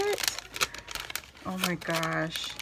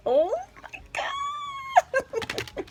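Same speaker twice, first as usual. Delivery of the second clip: very high, slow, stretched-out cry of joy; drawn-out shriek; 3 dB louder, much slower than usual. Real, not acted. Telling viewers about the gift card, a stretched high shriek. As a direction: let it soar and hang.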